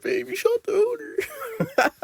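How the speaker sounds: very high falsetto, laughing through it; mock girlfriend squeal. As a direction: squeal it in shocked giggling disbelief. [name] voiced, laughing.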